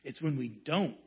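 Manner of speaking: soft, low ending that fades away; fading to hush. Real, not acted. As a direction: let it sink into a quiet close. Closing point fading to hush.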